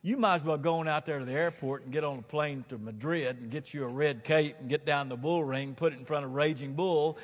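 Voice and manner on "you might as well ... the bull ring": normal talking voice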